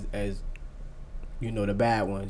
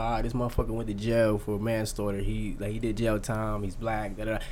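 low, quiet imitation of a cop; muttered cop aside; 4 semitones lower, 7 dB quieter than usual. Cop running his background to himself, low mutter. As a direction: mutter it lower, as if privately sizing someone up. Police reading his record to themselves.

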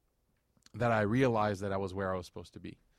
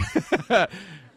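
loud, high burst of laughter; amused laugh; 9 semitones higher, 8 dB louder than usual. Real, not acted. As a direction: laugh out loud at your own story. Speaker laughs after voicing Salman.

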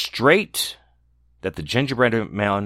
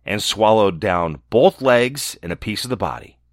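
ordinary delivery

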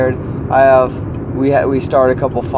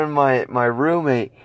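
normal talking voice

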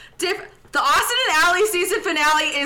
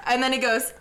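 ordinary talk

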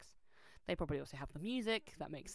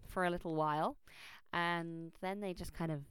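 usual speaking voice

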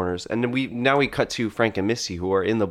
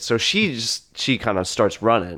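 usual speaking voice

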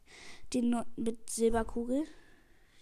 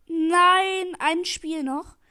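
loud, higher cry of protest; dismayed shout; 4 semitones higher, 9 dB louder, much slower than usual. Real, not acted. Gamer realising one more match, loud dismayed cry. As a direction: blurt it out in real dismay.

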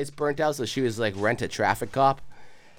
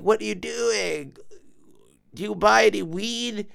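crackhead voice; 7 semitones higher than usual. Retold or imitated. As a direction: drag the questions out like a strange stranger. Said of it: "she had this crackhead voice".

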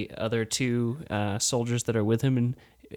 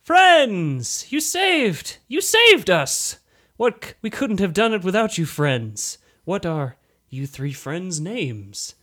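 much higher, loud gushing thanks; grateful villager; 7 semitones higher, 9 dB louder than usual. Acted. Short villager thanking the heroes, high overjoyed gush. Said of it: "He says".

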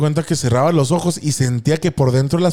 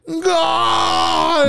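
grito agudo de gol; 13 semitones higher than usual. Retold or imitated. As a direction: grita gol como en el estadio. Everyone shouting goal. (todos gritan gol muy agudo)

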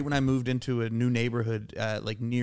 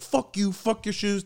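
higher-pitched mock tough retort; defiant brush-off; 9 semitones higher than usual. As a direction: imitate cocky defiance. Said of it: "I went from, like".

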